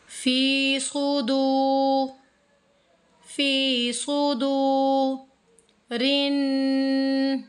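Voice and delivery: very slow, सुदू held long; stretched recitation. Shown as a demonstration. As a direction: draw out सुदू one alif length. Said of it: "खींच कर पढ़ना है". (सुदू को खींच कर)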